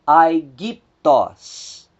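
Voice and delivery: "aigiptos" drawn out very slowly, syllable by syllable; very slow Greek demo. Shown as a demonstration. Teacher sounding out the word very slowly for students. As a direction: spell out "aigiptos" slowly with your voice. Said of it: "o acento recaiu exatamente sobre a segunda vogal".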